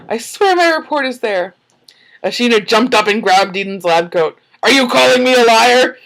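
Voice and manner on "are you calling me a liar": loud, high, indignant demand; angry accusation. Acted. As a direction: snap it back at her, offended. Acted character accusation.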